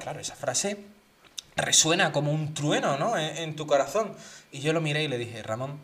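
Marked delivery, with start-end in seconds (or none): 5.4-5.8 a soft, solemn address. Retold or imitated. Telling a stranger from home, softly solemn. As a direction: say his name gravely, softer. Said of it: "aquí yo me puse solemne".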